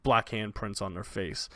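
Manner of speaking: ordinary talk, nothing marked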